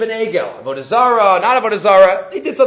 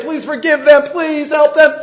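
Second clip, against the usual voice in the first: higher, louder plea to God; pleading call; 5 semitones higher, 3 dB louder than usual. Retold or imitated. Teacher voicing Moshe begging God to forgive the people, loud plea. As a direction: beg loudly for the people.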